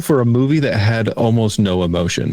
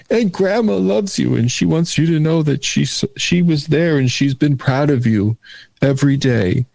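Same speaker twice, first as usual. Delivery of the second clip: higher, earnest child-actor impression; impression of a kid passing on a tender message; Haley Joel Osment; 4 semitones higher than usual. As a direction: deliver it higher, like an earnest little movie kid. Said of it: "really good Haley Joel Osment impression".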